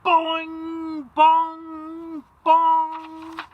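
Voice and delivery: high, loud ringing bongs like a church bell. Acted. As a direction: ring out each bong. Big bell rings under nest.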